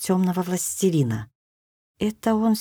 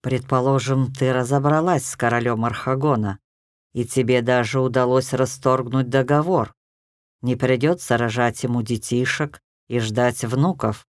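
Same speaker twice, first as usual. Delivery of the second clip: gloating; 7 semitones lower than usual. A narrator's reading. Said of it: "решил позлорадствовать".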